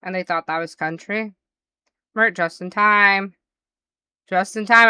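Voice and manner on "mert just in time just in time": louder, drawn-out welcome; excited greeting. Real, not acted. Welcoming Mert into chat, an excited loud greeting. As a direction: call out happily to a late arrival.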